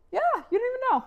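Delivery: high, loud burst of exasperation; emphatic exclamation. Retold or imitated. Wife to husband on the phone about the night's commotion, exasperated outburst. Acted reply to husband's call.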